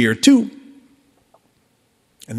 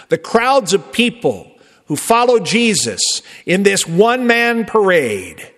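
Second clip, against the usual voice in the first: normal talking voice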